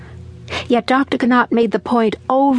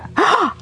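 sharp gasp; 11 semitones higher than usual. Acted. Child's upset thoughts.

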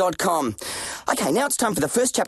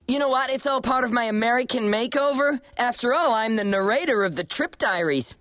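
put-on American accent; 3 semitones higher than usual. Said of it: "told by an Australian voice".

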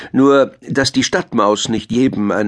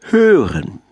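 higher, falling teacherly reveal; wise owl; 6 semitones higher, 3 dB louder than usual. A narrator's reading. Owl reveals the answer to the animals, wise teacherly tone. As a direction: give the answer with a measured flourish. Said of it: "sagte die kluge Eule lächelnd".

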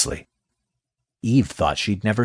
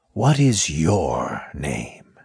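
very slow, low serpent; a sly snake, drawing it out; 3 semitones lower, much slower than usual. Acted. Snake speaks again.

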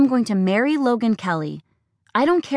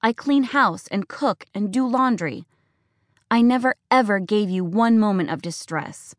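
higher, shrill-edged accusing rant; furious, hurt daughter; 4 semitones higher than usual. Acted. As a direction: pour out every grievance. Daughter's tearful tirade.

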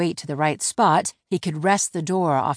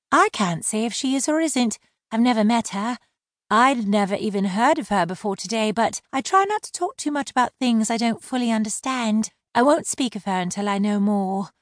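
much higher, dry; high-voiced mermaid mocking his slur; wry mermaid; 6 semitones higher than usual. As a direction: let the sarcasm sit lightly. Laurel measured.

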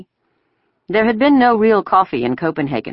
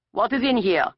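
raised, higher-pitched soldier's question; loud demand; 8 semitones higher, 3 dB quieter than usual. A narrator's reading. Soldier questioning a schoolgirl about her bag, loud demand. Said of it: "he asked loudly".

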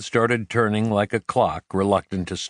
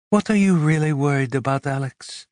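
higher, fast searching question; a woman asking her partner what truly worries him; probing female voice; 6 semitones higher than usual. A narrator's reading. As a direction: press him gently for the truth.

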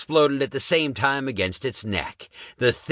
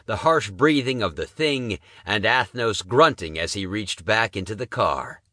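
ordinary delivery

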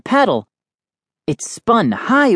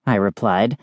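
plain reading voice

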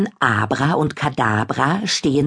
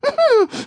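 high witch cackle. Acted. Kadabra mocks Abra.

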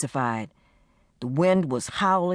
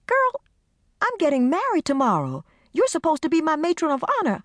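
incredulous; 13 semitones higher than usual. Acted. Carmen incredulous, high.

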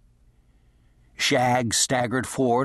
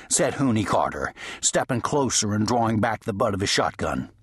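ordinary delivery

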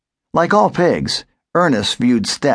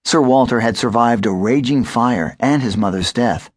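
ordinary delivery, nothing marked

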